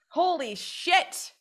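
slow, high, drawn-out disbelief; high slow holy shit; stunned. Real, not acted. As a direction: let it sink in. Overwhelmed by memory.

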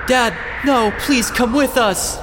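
put-on high, desperate child pleading; hammy dramatic reading. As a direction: beg him to come along. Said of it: "a dramatic reading for you".

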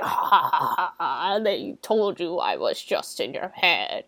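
mock villain laugh into low taunt; low, menacing killer impression; threatening killer. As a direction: do a hammy horror-villain voice.

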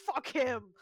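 loud mock outburst; retold exultant outburst. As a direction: burst out as the grudging girl. Retold girl after acing the test.

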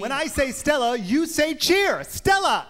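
shouted high call-and-response; cheer call. Acted.

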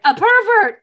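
high, loud alarmed shout; mock alarmed cry. Girl shouting a warning to everyone, high alarmed cry. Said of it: "one of the girls is like".

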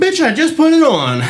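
mock retort. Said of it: "retold retort to boss".

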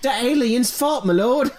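high, slow mock British lord; British accent. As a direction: ham up a Dickensian British servant.

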